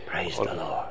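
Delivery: hushed praise. Acted. Quiet words after long silence.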